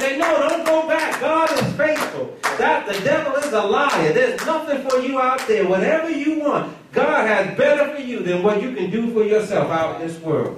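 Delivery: high, urgent exhortation to the congregation; impassioned preaching. Real, not acted. Preacher urging the church to help people stay in, impassioned. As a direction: preach it high, drawing out the key phrases.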